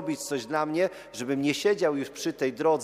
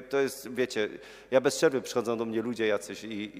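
ordinary talk, nothing marked

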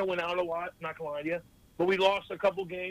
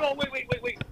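much higher, a quick rising wait wait; sudden realization. Retold or imitated. Realizing Guelph is the top team, high surprised stammer. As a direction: catch yourself mid-thought. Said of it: "I'm like".

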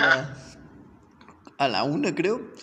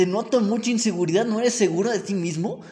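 ordinary talk, nothing marked

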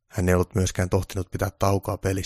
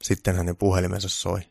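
usual speaking voice

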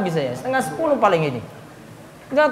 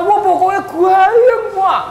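slow, higher and louder gushing reply; gushing congregant; 8 semitones higher, 4 dB louder, slower than usual. Acted. Congregant answering the preacher, slow and higher-pitched gushing. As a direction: sell how lively it was, dreamily.

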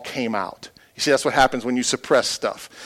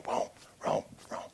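whispered; 16 dB quieter than usual. Real, not acted. Whispered aside in the story.

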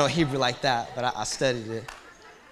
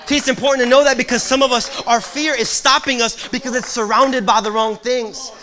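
loud, soaring preaching with the prayer voiced at the top; full-force sermon climax; 7 semitones higher, 13 dB louder than usual. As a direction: preach it full out to the back row. Preaching climax, fear postured to God.

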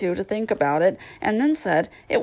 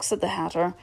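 ordinary delivery, nothing marked

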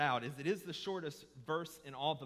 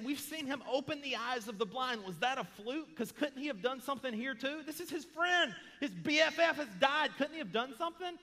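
high-pitched, indignant imitation of doubters, building louder; incredulous naysayers; 10 semitones higher, 5 dB louder than usual. Naysayers questioning why Jesus let his friend die, incredulous. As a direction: challenge it in acted disbelief. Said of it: "the naysayers are saying".

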